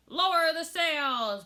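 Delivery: slow, loud storm order; shouting. A narrator's reading. Captain orders crew in storm, loud shout. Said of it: "Braidbeard shouted".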